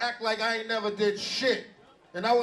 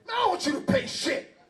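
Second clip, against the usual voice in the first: insistent shout; 7 semitones higher than usual. Retold or imitated. [name] insisting on paying for him.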